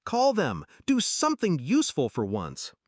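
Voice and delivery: loud, high, angry demand; barked order. A narrator's reading. Father furious over a lost client, barking at his child. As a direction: bark orders with furious impatience. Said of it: "he barked".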